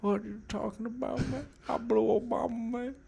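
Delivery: high, hushed exasperated impression; Joe Biden. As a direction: protest in a thin, soft politician voice. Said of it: "He's gotta be Joe Biden".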